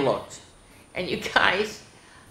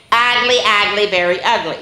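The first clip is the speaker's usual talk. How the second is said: put-on kids taunting; 3 semitones higher, 4 dB louder than usual.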